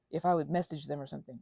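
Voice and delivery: ordinary delivery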